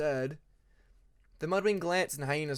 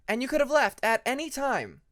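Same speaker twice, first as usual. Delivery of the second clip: high, breezy Hyena; 10 semitones higher, 6 dB louder than usual. A narrator's reading. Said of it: "shrugging her wings".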